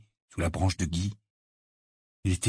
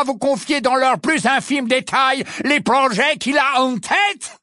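much higher, louder, slow contemptuous shouting; furious lecture; 12 semitones higher, 4 dB louder than usual. Acted. Portrait berating arrogant Harry, shouting contempt. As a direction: berate the boy at full voice.

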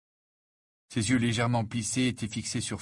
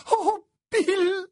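very high, loud wail of dismay; distressed mother; 6 dB louder than usual. A narrator's reading. Mother seeing her son's ravaged face, distressed high cry. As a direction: cry out in anguish, high-pitched.

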